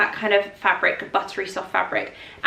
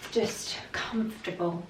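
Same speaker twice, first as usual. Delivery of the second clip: whispered; 3 semitones lower, 9 dB quieter than usual. Real, not acted. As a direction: whisper "just comfortable" under the breath. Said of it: "whispered add-on".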